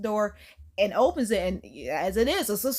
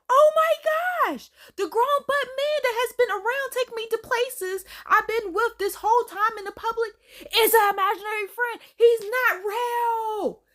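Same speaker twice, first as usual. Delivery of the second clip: shocked realization; 12 semitones higher than usual. Retold or imitated. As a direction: act out a high-pitched, stunned discovery. Acting girl's shocked realization.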